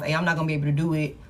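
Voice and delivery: rushed, tossed-off refusal; quick brush-off. Retold or imitated. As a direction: rattle it off to shut them down. Voicing client turning people down.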